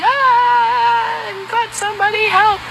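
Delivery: very high, strained cry of pain; snake crying for help. Acted. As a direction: wail like a trapped snake begging for help. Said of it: "And he starts crying".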